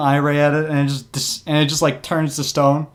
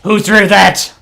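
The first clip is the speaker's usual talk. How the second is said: loud, raised beholder outburst; angry demand; 5 semitones higher, 8 dB louder than usual. Acted. Beholder struck by a rock turns around, angry shout. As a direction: demand the culprit, loud and higher.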